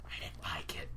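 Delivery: guilty whisper. Real, not acted. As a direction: whisper it like a confession. Confessing unpopular opinion.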